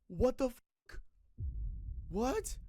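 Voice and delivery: high, sharp what the fuck; genuinely floored by her age; stunned disbelief. Real, not acted. Reacting to the teacher's age.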